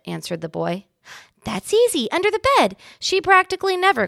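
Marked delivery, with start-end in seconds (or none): 1.4-4.1 mouse voice. A narrator's reading. Ralph answers confidently.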